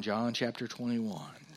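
ordinary delivery

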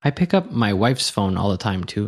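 ordinary talk

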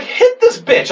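very high-pitched re-enacted pleading order; exasperated demand. Skunk holder orders the cop to hit, exasperated. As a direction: demand it at the top of your range.

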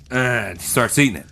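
ordinary talk, nothing marked

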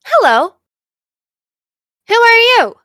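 high, loud little robot greeting; computer character voice. Acted. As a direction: chirp it like an emoticon computer. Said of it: "And it goes".

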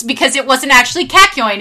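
normal talking voice

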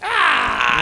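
loud, very high "ah"; mimicked kid's high cry; imitated high squeal. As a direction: squeal back at hearing your name. Acting out kid's reply to name.

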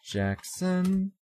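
ordinary talk

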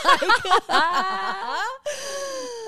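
high, loud, long cackle; helpless laughter. Real, not acted. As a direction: crack up and lose it completely. Cracks up, told keep it together.

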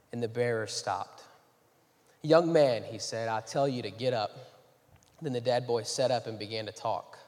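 soft, low Bible reading; scripture reading. Acted. Pastor reads Luke to the church, quiet and reverent. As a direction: read the verse reverently.

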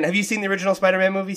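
usual speaking voice

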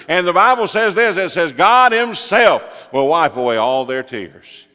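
loud, high, building sermon delivery; impassioned preaching. Acted. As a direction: preach it at full force, rising to a thunder. Preaches at full force.

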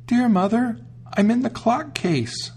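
put-on very high, small child's voice; youngest kid calling. The youngest kid calls to mother from the clock case, high and small. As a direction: call out like the tiny hidden kid. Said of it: "she heard a soft voice calling".

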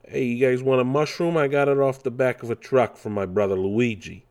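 streetwise New York hustler Mario; New York accent. Retold or imitated. Mimicking Mario for viewers, New York accent. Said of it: "he's talking like that almost".

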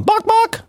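very high playful squawk; imitated bok-bok. Host signing off the Hover ad, high mock squawk. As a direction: squawk two short, high-pitched boks. Mimics noise closing the ad.

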